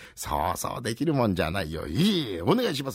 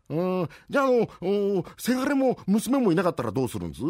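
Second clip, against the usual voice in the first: eager nosy townsman; 10 semitones higher than usual. Acted. Rakugo, nosy townsman asks.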